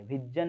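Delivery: विजन with a hard J, "vijjan"; wrong J sound. Shown as a demonstration. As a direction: say विजन with J in place of buzzing Z. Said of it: "नहीं बोलते".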